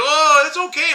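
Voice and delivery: a hint of a breezy buddy; airy brush-off. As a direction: lightly mimic the friend waving it off. Friend brushing off the worry.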